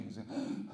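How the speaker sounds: imitated breathless gasping; heaving mock gasps; panting gasps. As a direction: gasp for air like a lost hunter. Gentleman is panting.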